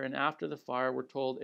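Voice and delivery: usual speaking voice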